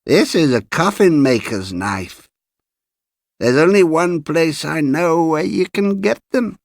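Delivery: put-on high old coffin maker showing off his knife; cracked-bell voice. A narrator's reading. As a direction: let the old man's voice climb high and cracked. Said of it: "in a voice like a small, cracked bell".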